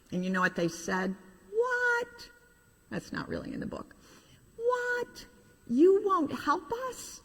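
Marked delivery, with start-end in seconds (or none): 1.5-2.3 tiny very high disbelieving squeak; shocked squeak. Acted. Toys stunned a third time, ad-libbed squeak. 4.6-7.2 very high, slow disbelieving cry; shocked toys. Acted. Toys outraged as the old engine refuses, very high cry.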